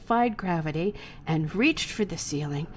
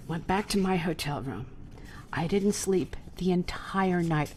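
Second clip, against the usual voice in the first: normal talking voice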